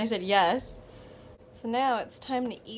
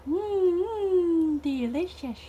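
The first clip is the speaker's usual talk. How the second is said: playful savouring; 10 semitones higher, 5 dB louder, much slower than usual. Acted. Playfully savouring food for Autumn.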